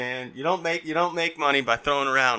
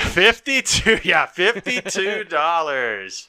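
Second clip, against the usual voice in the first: mock announcer echo; 4 semitones higher, 4 dB louder, much slower than usual. Echoing the total.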